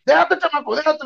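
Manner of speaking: high, insistent retold order; struggling cop. Skinny cop twisting the kid's arm, insistent.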